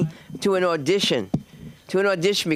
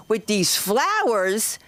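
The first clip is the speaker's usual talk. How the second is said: normal talking voice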